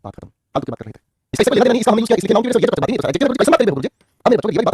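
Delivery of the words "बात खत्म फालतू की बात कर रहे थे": soft, much lower aside; quiet, low-pitched mock dismissal. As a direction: drop it low and quiet to close the matter. Same dismissal repeated, dropped low.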